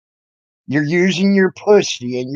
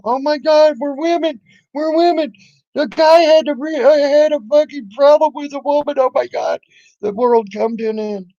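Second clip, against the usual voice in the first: much higher mock whine; mocking girly voice; 10 semitones higher than usual. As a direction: ham up her outrage in a high voice. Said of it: "You brought it up on your panel".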